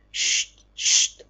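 soft whispered shushing; soft whispered shh; whispered hush. A narrator's reading. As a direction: hush him gently. Dying man hushes narrator.

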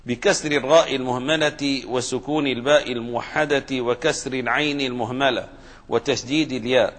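normal talking voice

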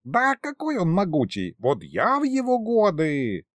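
put-on high, boastful old man; high-pitched amused scoffing; giggly old sorcerer. A narrator's reading. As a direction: brag gleefully, stretching the ending. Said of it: "но тут же захихикал".

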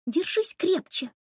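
urging. A narrator's reading. Rycha encourages hanging student.

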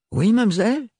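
put-on high young maid with a thick provincial French accent. A narrator's reading. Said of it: "L'accent très prononcé de l'adolescente".

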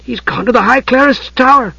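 high, aghast exclamation. A narrator's reading. Tas grasping where Palin went, aghast. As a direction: exclaim it in shocked realisation. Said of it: "Tas paused aghast".

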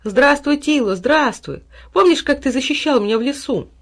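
high, delighted hello; joyful greeting. A narrator's reading. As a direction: greet a beloved dog with joy. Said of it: "радостно обнял пса за шею".

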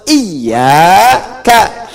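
"iyyaka" with a doubled, held y; iyyaka bertasydid. Shown as a demonstration. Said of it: "hanya kepada engkau". ("iyyaka" dengan tasydid, panjang dan lantang)

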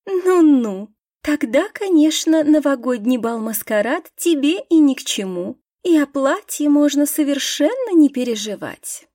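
sarcastic, high and loud. Acted. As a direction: drip with mock sympathy. Язвительная насмешка над Лидией. (язвительно, с издёвкой)

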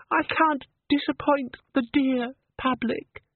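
a brave smile you can hear, overdone; brave little diva. Acted. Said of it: "She gave him a brave smile".

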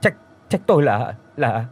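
teeth chattering, words tripping over each other; terrified stammer. Acted. Terrified husband stammering after ghost. (run rẩy lắp bắp vì sợ)

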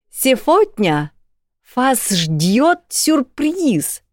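high, slow and cheerful; cheerful announcement. Acted. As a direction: announce a treat to the students. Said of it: "весело обрадовала ректор".

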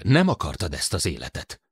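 plain reading voice

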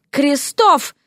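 high, indignant summons; indignant call. A narrator's reading. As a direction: call out the name sharply. Lady calls husband to defend her.

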